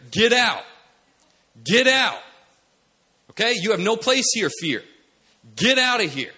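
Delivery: loud, high-pitched order to leave; commanding. Acted. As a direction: drive fear out with a forceful shout. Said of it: "Love says to fear".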